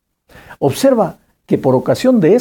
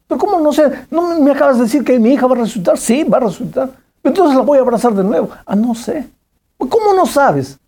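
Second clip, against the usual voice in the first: excited insistence; 9 semitones higher, faster than usual. Retold or imitated. Father presses the girl, excited.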